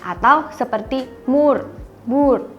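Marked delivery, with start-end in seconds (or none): 1.3-2.5 "mur", "bur" said slowly with a long U as in tamu; Dutch long U. Shown as a demonstration. Teacher showing the long Dutch vowel, slow careful U. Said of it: "huruf U-nya itu berbunyi seperti U pada kata tamu".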